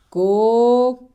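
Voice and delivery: "Cocodrilo" split into long, separate syllables; sounding out. Shown as a demonstration. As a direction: dictate "Cocodrilo" with long pauses between syllables. Sounds out word while writing.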